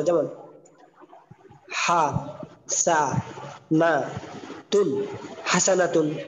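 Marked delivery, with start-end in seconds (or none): 1.8-5.1 হা, সা, না, তুন as separate drawn-out syllables with pauses; broken-up teaching pronunciation, a gap after each of হা, সা, না, তুন. Shown as a demonstration. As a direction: say হা, সা, না, তুন one slow piece at a time. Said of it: "একটা একটা করে উচ্চারণ বলা".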